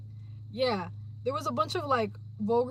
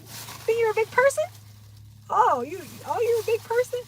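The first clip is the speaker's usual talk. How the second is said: high, teasing challenge; mocking taunt; 10 semitones higher, 5 dB louder than usual. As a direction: needle them for acting grown. Taunting someone who thinks they're tough.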